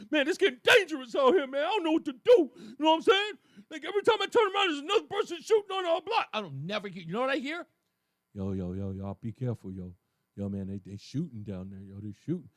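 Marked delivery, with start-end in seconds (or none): 0.1-6.3 loud, higher, teary, fast lament; mock distraught. Host mocking people crying about shootings, loud and teary. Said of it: "people in the street going". 8.3-12.5 much lower, flat offhand street voice; deadpan casual warning. Retold or imitated. Host imitating a bored neighbor, much lower and deadpan. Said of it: "No emotion to speak of".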